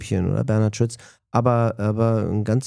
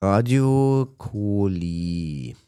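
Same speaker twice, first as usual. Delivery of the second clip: very slow, stretched-out station name; drawn-out announcement; much slower than usual. Real, not acted. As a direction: draw out each word of the name. Answers best-station question slowly, for effect.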